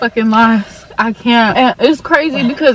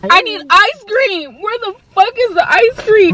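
desperate; 9 semitones higher than usual. Real, not acted. Desperate from spicy wings.

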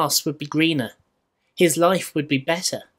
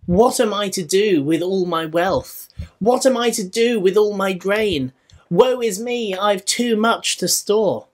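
mock lament; 5 semitones higher, 3 dB louder than usual. Rich fool laments his wealth.

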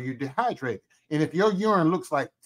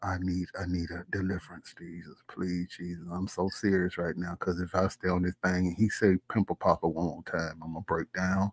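low hushed pleading, half whispered; mock desperate prayer; 9 semitones lower, 6 dB quieter than usual. Cohost pretends to pray for deliverance, low hushed plea. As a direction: beg Jesus softly and low, hammed-up. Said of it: "mock pleading prayer".

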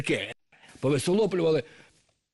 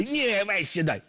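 high slow bossy voice; 9 semitones higher, slower than usual. Acted. Snake girl orders Telesyk.

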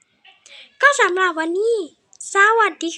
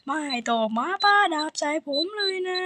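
softer, pleading under the breath; quiet repeat; 5 semitones lower, 8 dB quieter than usual. Real, not acted. Gamer begging the sword-throwing lady, said softly and meekly. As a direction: let the plea drop down soft and low.

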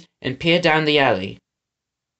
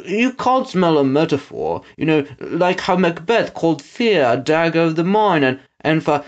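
henchman stammering out a correction; Trout, exasperated; 3 semitones higher than usual. A narrator's reading. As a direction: explain it with mild exasperation.

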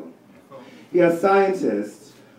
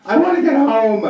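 whiny plea; 12 semitones higher than usual. Retold or imitated. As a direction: beg like an impatient kid. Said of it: "I'm like".

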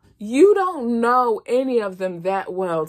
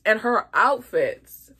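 normal talking voice

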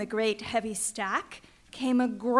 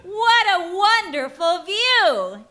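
excited; 8 semitones higher, 7 dB louder than usual. A narrator's reading. Narrator exclaims Yertle's delight.

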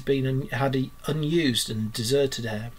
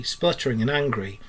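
usual speaking voice

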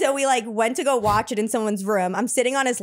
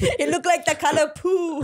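put-on higher French-accented disgust; 4 semitones higher than usual. Frenchman mocks her dress to his girl, French accent. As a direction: keep up the Frenchman's scornful accent. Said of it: "He's like".

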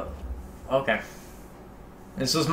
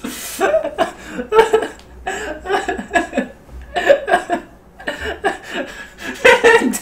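laughing hard; 10 dB louder than usual. Real, not acted. Laughing hard after prank call.

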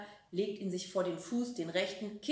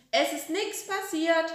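put-on high, loud child's voice; calling back; 9 semitones higher, 6 dB louder than usual. A narrator's reading. Frieder calls to grandma that nothing happened, loud. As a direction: call out innocently. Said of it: "ruft der Frieder zurück".

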